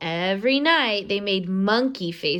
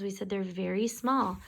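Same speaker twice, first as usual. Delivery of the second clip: ordinary delivery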